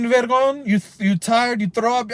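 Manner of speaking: usual speaking voice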